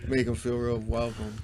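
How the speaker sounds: normal talking voice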